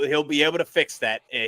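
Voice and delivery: usual speaking voice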